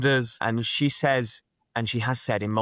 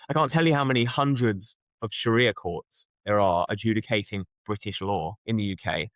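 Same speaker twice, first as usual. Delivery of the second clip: usual speaking voice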